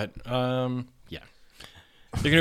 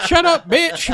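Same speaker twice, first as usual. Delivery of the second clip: mock shouting; 11 dB louder, slower than usual. [name] yelling at father.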